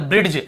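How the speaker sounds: "ब्रिड्ज" as brid-j, d heard; D wrongly sounded. Shown as a demonstration. Teacher repeats the common misreading, d sounded. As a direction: pronounce the d inside "ब्रिड्ज". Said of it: "ऐसे पढ़ देते हैं हम".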